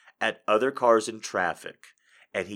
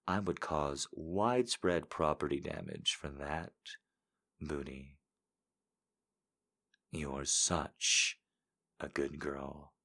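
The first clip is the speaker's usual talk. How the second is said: seductive voice; 7 semitones lower, 10 dB quieter than usual. Pretend. Reading a viewer quote in a low sultry voice. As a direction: purr it low and intimate. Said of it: "I say it".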